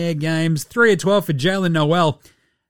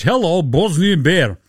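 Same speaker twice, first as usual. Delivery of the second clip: slow, put-on Bosnian accent; wobbly Bosnian accent; 4 dB louder, slower than usual. Host saluting the Bosnian Bear, mock Bosnian accent. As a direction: greet him in a hammed-up Bosnian accent. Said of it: "that accent went a couple of different ways".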